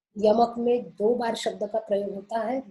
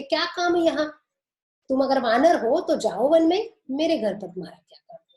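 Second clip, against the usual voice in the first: playful teasing jab, pitched up; mocking tease; 4 semitones higher, 5 dB louder than usual. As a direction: tease him like Radha mocking a monkey. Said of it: "वो कृष्ण से मजाक करती है". (राधा का कृष्ण से चिढ़ाता मज़ाक)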